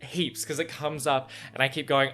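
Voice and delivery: ordinary delivery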